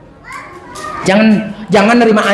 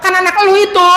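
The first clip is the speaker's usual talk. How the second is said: higher mocking wife; wife retorting; 7 semitones higher, faster than usual. The wife reminds the husband it is his own child, higher and scornful. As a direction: snap back as a scornful wife.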